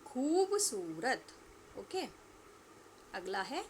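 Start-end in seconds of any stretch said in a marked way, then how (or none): 0.1-1.3 खूबसूरत said very slowly with a long, loud खू; drawn-out model word. Shown as a demonstration. Teacher models a word for pupils to repeat, slow and drawn out. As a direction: stretch खूबसूरत syllable by syllable. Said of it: "आप सभी को मेरे पीछे पीछे दोहराना है".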